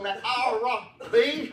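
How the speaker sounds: "Ira B" drawn out high and rising; loud, lifted "Ira B"; emphasis on Ira B. Retold or imitated. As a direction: punch and stretch "Ira B". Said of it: "he would put that emphasis".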